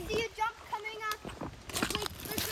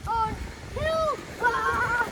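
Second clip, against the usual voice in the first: scared yelp; 3 semitones higher, 9 dB louder, much slower than usual. Real, not acted. Slipping while running down.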